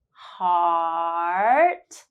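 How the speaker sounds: "Heart" with the ah held three seconds. Shown as a demonstration. Teacher holding the ah in "Heart" for learners. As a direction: stretch the ah in "Heart" long. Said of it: "hold ah for three seconds for the first time".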